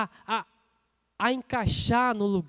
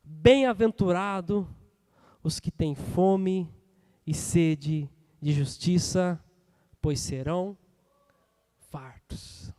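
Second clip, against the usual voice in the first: very slow, weighty reading, sinking lower to a hush; solemn scripture proclamation; 3 semitones lower, 4 dB louder, slower than usual. Real, not acted. Preacher reads to the hungry for justice, solemnly slow. Continues proclaiming the verse slowly.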